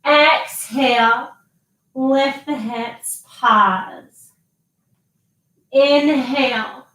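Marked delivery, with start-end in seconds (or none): none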